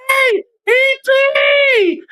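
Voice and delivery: very high, long-drawn squeal; mock yelled greeting. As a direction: shriek a stretched-out hello in mockery. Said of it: "having a fucking stroke".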